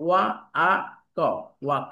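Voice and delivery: ওয়া, আ, ক spoken apart, then ওয়াক; sounding out British. Shown as a demonstration. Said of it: "তাহলে কি হলো".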